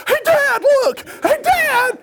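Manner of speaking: put-on very high boyish yell; acted shriek for attention; excited calling out. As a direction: holler to Dad far away. Boy yelling to distant father.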